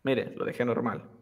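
normal talking voice

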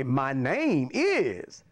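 very slow, spelled-out "My name is Wade Hooks"; slow, deliberate model answer; demonstrated full sentence. Shown as a demonstration. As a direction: draw out "My name is" before the name. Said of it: "he wanted me to say".

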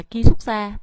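ki xuất ra with ki unlowered, Vietnamese-style; Vietnamese-sounding Korean. Shown as a demonstration. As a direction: read ki xuất ra with a Vietnamese accent. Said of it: "thì rất là Việt Nam".

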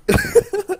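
shrieking laugh; high burst of laughter. Real, not acted. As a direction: cackle helplessly. Friend's big win, losing it.

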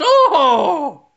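put-on hammy high, slow Irish accent; Irish police chief. Police chief greeting the sergeant in a hammy Irish accent. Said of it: "I'll be there as, as, uh, Chief O'Leary".

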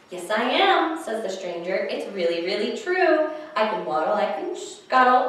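lively storytime read-aloud; animated picture-book reading; reading to children. Acted. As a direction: read it like storytime for little ones.

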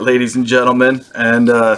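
ordinary delivery

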